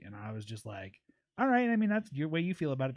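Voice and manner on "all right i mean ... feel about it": high-pitched "all right" easing down; mock-agreeable brush-off. Streamer answering a critical chatter, breezy brush-off. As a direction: humor the critic, starting high.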